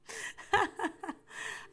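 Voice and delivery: high-pitched, airy burst of laughter. Real, not acted. Tarot reader reacting to a message, laughing.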